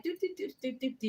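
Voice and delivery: high, soft "do do do"; light, tripping little sound effect; sing-song travel noises. Retold or imitated. Hopping off the bus for groceries, high soft doo-doos.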